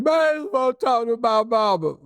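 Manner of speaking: high, wailing sobbing plea; crying for mama. Retold or imitated. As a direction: blubber like a scared kid begging for his mama. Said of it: "And he's crying".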